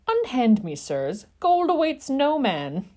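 feeble protest. A narrator's reading. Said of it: "he protested feebly".

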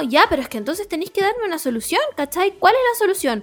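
high-pitched, pressing demand for an answer; frustrated phone argument; exasperated. Retold or imitated. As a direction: reenact a fed-up caller insisting, pitch climbing.

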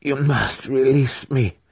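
high, drawn-out spirit's plea; shrieking spirit. A narrator's reading. Spirit pleading with the wizard for release, shrieking. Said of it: "the spirit shrieked".

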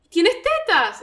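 loud, high disbelieving question; seeing her grown sister, loud amazed blurt; astonished question. Retold or imitated. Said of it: "le digo".

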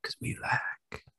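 breathy seductive whisper; sultry whisper. Acted.

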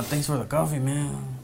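put-on Mexican accent. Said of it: "that Mexican accent we like to do".

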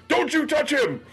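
high, strained mock-furious warning; protective brother. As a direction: stand up for your little brother, voice way up. Brother standing up to stepmom.

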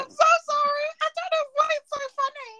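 high, laughing apology; very high giggly sorry; giggling apology. Retold or imitated. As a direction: apologize while still giggling. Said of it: "I was like".

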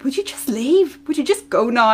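a hint of a kid shooing her parent out; impatient daughter. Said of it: "my daughter would be like".

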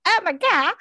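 high, loud star-struck gasp; inner squeal. Retold or imitated. Her real inner reaction, star-struck squeal. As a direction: squeal inside. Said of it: "But I'm really like".

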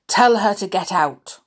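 loud, grumpy demand; insistent. Acted. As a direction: insist on it loudly, like a grumpy dwarf. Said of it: "Grumpy insisted".

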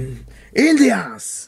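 lightly hammed-up announcer; mock finalist announcer. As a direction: call out the act like a TV announcer. Mimics finalist announcement roll.

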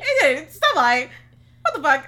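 very loud, high words through laughter; laughing. Real, not acted. Reliving the awkward goodbye, laughing loudly.

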